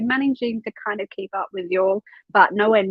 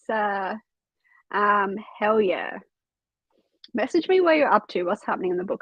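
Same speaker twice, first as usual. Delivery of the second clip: ordinary talk, nothing marked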